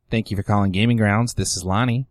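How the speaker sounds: phone greeting voice. Retold or imitated. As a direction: answer like a stiff shop clerk. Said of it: "I give it the".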